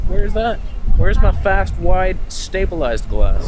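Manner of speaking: mock outrage. Real, not acted. Demanding stabilized lenses.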